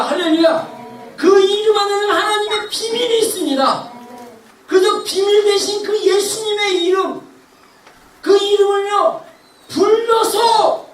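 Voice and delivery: impassioned preaching. Real, not acted. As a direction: drive each phrase home to the congregation. Preaching at full force. (힘차게 외치는 설교)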